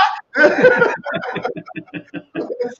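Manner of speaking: breathless high laugh climbing up; high wheezing laugh. Real, not acted. Losing it laughing at his own story.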